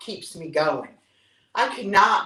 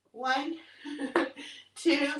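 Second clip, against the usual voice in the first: usual speaking voice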